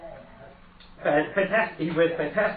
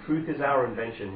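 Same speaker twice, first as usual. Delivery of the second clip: ordinary talk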